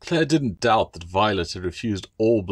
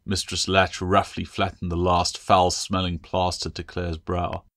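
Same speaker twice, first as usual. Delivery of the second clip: plain reading voice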